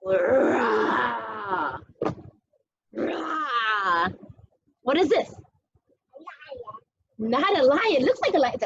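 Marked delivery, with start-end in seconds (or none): 0.0-1.8 low, drawn-out pretend roar; playful tiger roar. Parent playing tiger for toddlers, low pretend roar. 2.9-4.1 low, long mock roar; playful tiger roar. Parent playing tiger for toddlers, mock roar.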